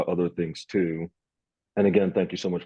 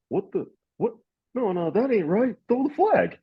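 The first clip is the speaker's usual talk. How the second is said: much higher, incredulous mock protest; imitating outraged buddy; 14 semitones higher than usual. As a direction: play the friend who can't accept the call. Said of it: "my buddy Jordan is just".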